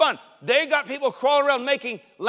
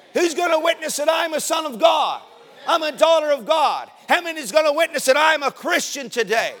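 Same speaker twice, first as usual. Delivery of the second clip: raised-pitch revival call; rallying preaching; 5 semitones higher than usual. Acted. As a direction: rouse the church to testify. Rallies the congregation.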